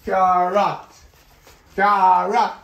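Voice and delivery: "Carrot" loud in two stretched syllables; loud word drill. Acted. Grandchild drilling grandma on a word, loud and deliberate. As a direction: punch out each "Carrot" firmly.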